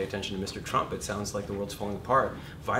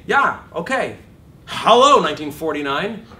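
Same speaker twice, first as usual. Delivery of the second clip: higher and louder sarcastic dismissal; mock scoff; 6 semitones higher, 13 dB louder than usual. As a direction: sneer at the outdated rule. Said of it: "I say".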